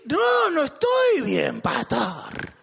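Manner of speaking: high, slow, hammed-up wail; agitated protest. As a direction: cry out the complaint theatrically. Acts the churchgoer protesting to pastor. (protesta a gritos, exagerada)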